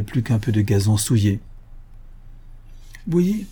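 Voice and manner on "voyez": high-pitched, with a smile; smiling. A narrator's reading. Son-in-law shows off his work to the narrator, smiling. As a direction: lift it high, smugly pleased. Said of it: "fit mon gendre en souriant".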